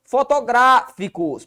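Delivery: "Fotográficos" slow, GRÁ held and set apart; stressed syllable stretched. Shown as a demonstration. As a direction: pause after a strong GRÁ in "Fotográficos". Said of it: "proparoxítona". ("Fotográficos" devagar, com GRÁ alongado)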